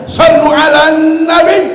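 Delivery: high, loud shout to the crowd; a rousing preacher's cry; loud call-out. Real, not acted. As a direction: call it out to rally the audience. Preacher calls out to congregation.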